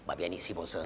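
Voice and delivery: coaxing undertone. Acted. Owner coaxing his dog quietly forward. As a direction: coax the dog under your breath.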